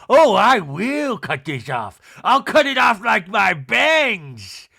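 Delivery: loud, drawn-out mock defiance; Meredith impression. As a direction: stretch each word into a hammed-up threat. Mimics Meredith's defiant retort.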